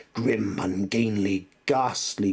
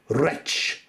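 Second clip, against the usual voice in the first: cried out; 6 semitones higher than usual. Acted. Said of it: "I cried".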